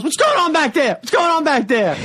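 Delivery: high-pitched imitated shout to the back; host calling out. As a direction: play a club host hollering at a commotion.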